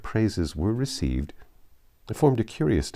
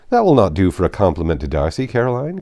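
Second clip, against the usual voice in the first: teasing brother; 8 dB louder than usual. Acted. Bingley teasing his sister.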